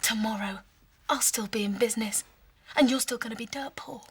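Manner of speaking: low, soft hushed contempt; contemptuous whisper. Acted. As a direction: whisper it with scorn. Hushed quarrel.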